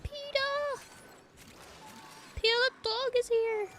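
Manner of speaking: silly high-pitched announcement; put-on high voice. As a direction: pitch it way up, playfully. Repeats the line in put-on voice.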